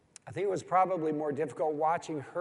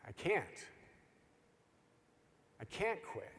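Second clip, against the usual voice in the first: quiet resolve; 10 dB quieter than usual. Retold or imitated. Blistered girl refusing to quit.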